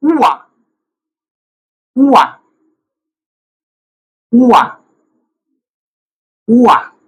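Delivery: teacher demonstrating उवा up high, syllable by syllable; Swedish pronunciation demo. Shown as a demonstration. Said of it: "इसकी pronunciation ऐसे बनेगी".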